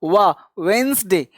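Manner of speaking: slow "वा", then "वेंसडे" with a W-like start; V-not-B pronunciation. Shown as a demonstration. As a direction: open "वेंसडे" on "वा", never a B sound. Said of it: "ब नहीं बोलना". ("वेंसडे" की शुरुआत व से, धीरे)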